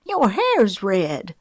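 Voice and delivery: slow, startled exclamation; exclaiming. Acted. Grandma startled by Luna's red hair, exclaiming. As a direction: blurt out the surprise. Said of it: "Grandma Peach exclaimed".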